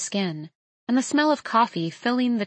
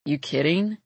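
plain reading voice

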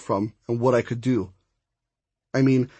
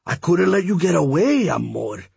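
put-on higher accented character voice, falling at the end; amorous suitor; 5 semitones higher than usual. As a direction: woo her like an old-fashioned suitor.

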